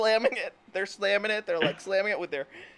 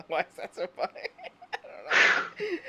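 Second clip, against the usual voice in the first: real giggles breaking up the words; real laughter spilling into speech; laughing through words; 2 semitones lower than usual. Real, not acted. Can't stop laughing at joke.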